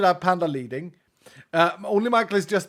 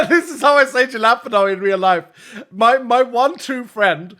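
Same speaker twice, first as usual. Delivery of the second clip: louder and higher, through a laugh; loud amused protest; 6 semitones higher, 9 dB louder than usual. Real, not acted. Protests his own pronunciation, laughing.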